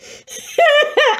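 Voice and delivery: high, loud, wheezing laugh burst; real helpless laughter. Real, not acted. About to double over at the exercise, helpless high laugh. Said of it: "I'm just about to double over".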